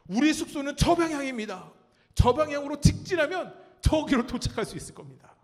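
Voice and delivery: loud, high proclamation of the way; confident declaration. Retold or imitated. Preacher re-enacting himself directing the young people, loud and confident. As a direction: announce the direction with booming confidence. Retold confident pointing to the group.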